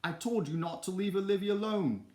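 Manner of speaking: high, loud storybook scolding; scolding cry. A narrator's reading. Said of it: "cried Basil".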